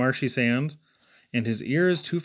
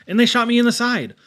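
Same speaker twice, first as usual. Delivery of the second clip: fast, high and loud, the shot hitting; excited burst; 7 semitones higher, 5 dB louder, faster than usual. A narrator's reading. Boy telling the wild ride, suddenly fast and loud. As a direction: rush it out as if just hit. Dramatic story climax.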